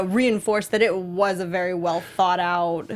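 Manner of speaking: normal talking voice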